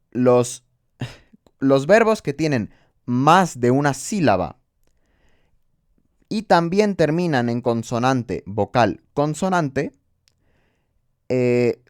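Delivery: normal talking voice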